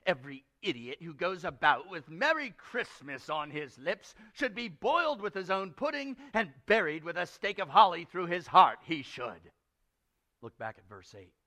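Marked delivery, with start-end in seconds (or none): 0.1-9.5 put-on grumpy old uncle's tirade; indignant. Uncle curses merry idiots to nephew, indignant. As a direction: build to a loud peak, then drop off. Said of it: "said Scrooge indignantly".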